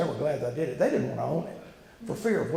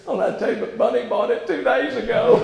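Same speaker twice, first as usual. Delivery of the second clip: laughing quote; 8 semitones higher, 8 dB louder than usual. Retold or imitated. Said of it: "He said".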